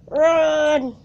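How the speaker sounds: loud urgent shout. Real, not acted. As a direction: yell to flee. Fleeing in game.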